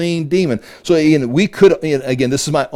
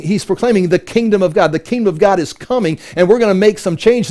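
ordinary talk, nothing marked

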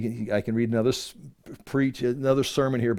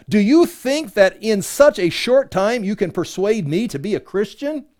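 higher, emphatic questioning; scripture reading; 6 semitones higher, 3 dB louder than usual. Acted. Agrippa questioning Paul's attempt to persuade him, emphatic scripture reading. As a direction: read it out as a pointed challenge.

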